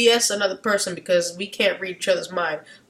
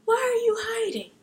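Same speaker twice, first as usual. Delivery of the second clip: much higher, mock-puzzled voice of God; a hammed-up God, pitched way up; God asking; 13 semitones higher, 5 dB quieter than usual. God asks Adam why he hides, in a high mock voice. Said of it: "He's like".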